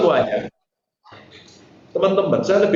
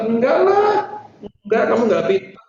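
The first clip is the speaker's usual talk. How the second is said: mock scolding; 6 semitones higher than usual. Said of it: "Saya bilang gitu".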